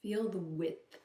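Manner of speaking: soft, unhurried meditation voice with long pauses; softly led grounding exercise; guided meditation. Acted. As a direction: gently guide the listener's attention inward, leaving space between instructions.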